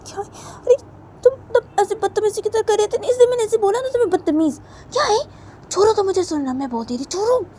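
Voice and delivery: very high, loud indignant cry; shrill angry protest. Acted. Simran shouting at Sona to let go, very high and loud. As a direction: scream it back, pitched right up.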